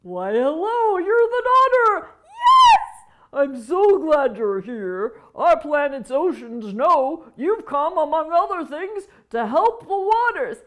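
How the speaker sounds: high, loud, slow voice; a big friendly whale; booming whale greeting. Acted. Whale speaks.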